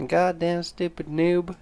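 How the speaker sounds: slow, high scolding crone; crazy old lady. Acted. Crazy old lady berates stupid victim, slow high scolding.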